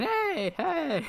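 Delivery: very high, thin cheer at modest volume; high drawn-out crowd hey; mock crowd cheer. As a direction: imitate fans cheering from the stands. Mimics the cheering fans.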